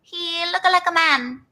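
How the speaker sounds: high sketch-comedy character voice; high-pitched TV character impression; Missus Swan. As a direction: put on a high, comic accented catchphrase voice. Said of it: "like, Missus Swan".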